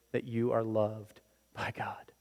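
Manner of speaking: hushed whisper of assurance. Acted. Preacher whispering God's love to the congregation. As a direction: drop to a whisper.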